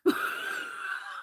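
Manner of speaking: airy unvoiced chuckle; breathy laugh. Real, not acted. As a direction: laugh under your breath. Laughing after story.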